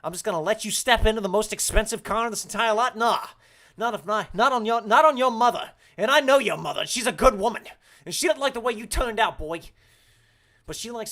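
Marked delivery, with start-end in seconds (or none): 0.0-9.6 loud, high mock-angry tirade; indignant rant. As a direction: shout down the complainer in character.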